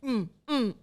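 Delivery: ordinary delivery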